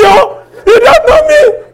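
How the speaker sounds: shouting, high and loud, threatening; furious threat. Retold or imitated. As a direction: yell it like you've lost your cool. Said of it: "I lost control of myself".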